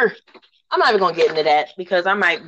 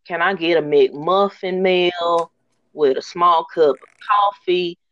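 slow, drawn-out Southern drawl on the order. Retold or imitated. Customer at the drive-thru ordering in a slow Southern drawl. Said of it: "in his slow Southern drawl".

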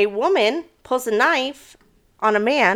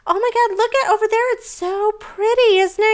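faked enthusiasm; 13 semitones higher than usual. Scared parasailer pointing out the view to partner, high faked delight. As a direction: gush about the view to cover panic. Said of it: "I'm, like, freaking out, but I'm like".

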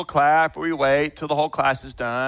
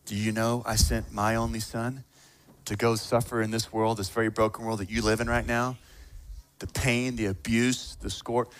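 soft, low, earnest voice; tender Father in heaven; 6 semitones lower, 7 dB quieter than usual. Acted. God the Father telling of sending his only son, hushed and earnest. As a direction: speak low and gently, as a father. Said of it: "Father in heaven who's saying".

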